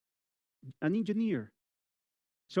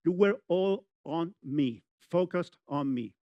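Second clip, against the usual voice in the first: slow emphasis; slower than usual. Real, not acted. Stressing the audience's attention.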